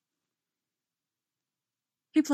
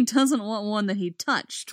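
plain reading voice

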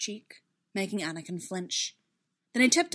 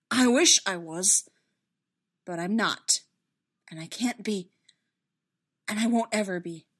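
despairing; 5 dB louder than usual. Acted. Anakin despairing.

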